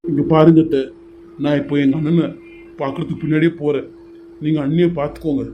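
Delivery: loud, firm anger at his aunt; angry confrontation. Acted. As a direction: lay down the law to her. Nephew confronts aunt angrily.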